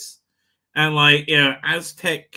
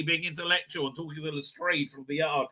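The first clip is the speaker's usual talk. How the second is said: ordinary talk, nothing marked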